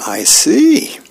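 a sudden high squeaky reply; surprised sergeant. Acted. Sergeant reacts in comic.

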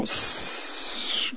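long hiss of air escaping; imitated deflating hiss. Beach ball losing its air after the safety pin, deflating hiss. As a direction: let out a drawn-out sss like a punctured ball. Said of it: "goes flat".

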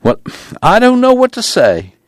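very high-pitched, flustered confession; falter, then rise high in distress; helpless protest. A narrator's reading. Preacher helpless at request.

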